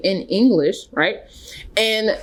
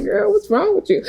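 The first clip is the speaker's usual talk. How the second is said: surprised; 13 semitones higher than usual. Retold or imitated. Voicing the counselor's reaction.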